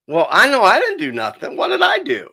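indignant disbelief. Retold or imitated. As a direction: protest your innocence, voice climbing.

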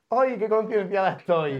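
lightly put-on, self-satisfied celebrity gush; mock smug celebrity. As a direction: parody a vain famous woman showing off. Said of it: "en plan".

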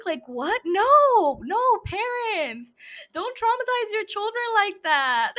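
high-pitched, exaggerated protest; mock scolding. Host telling older parents not to traumatize children, mock scolding. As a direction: plead in hammed-up, high exasperation with a drawn-out no.

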